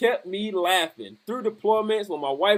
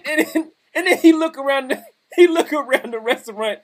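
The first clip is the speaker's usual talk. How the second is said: usual speaking voice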